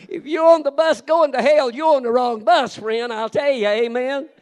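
rally the congregation from the pulpit; full-force preaching. Acted. Preacher calling the church to open their Bibles, loud and ringing.

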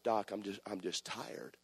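soft, drained complaint trailing away; tired. Retold or imitated. Preacher complaining to Doc on the phone, soft worn-out voice. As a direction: let it fade out, spent. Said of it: "I was tired".

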